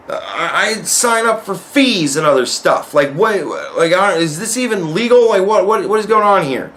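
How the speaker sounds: high, exasperated complaint; arguing with the clerk about fees, pitched high; retold indignation. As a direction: argue it with rising disbelief. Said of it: "and I'm like".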